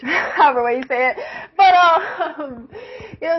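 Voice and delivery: words broken by laughter; giggling as she talks; laughing. Real, not acted. Laughing while telling story.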